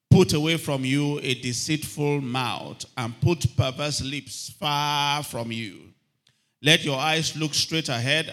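full-voiced Bible reading from the pulpit; scripture reading. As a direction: proclaim the verses to the congregation. Reads scripture aloud.

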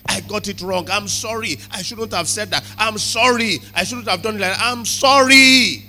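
high, building to a loud drawn-out cry; acted pleading that swells to a shout; impassioned apology. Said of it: "is to come and say".

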